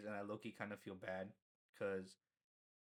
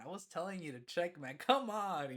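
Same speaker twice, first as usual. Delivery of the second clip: retold exasperation; 6 semitones higher, 5 dB louder than usual. Retold exasperated complaint to dean.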